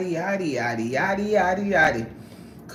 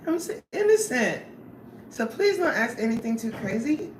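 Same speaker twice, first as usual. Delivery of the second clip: mock innocent; 7 semitones higher than usual. Playfully claims to be innocent.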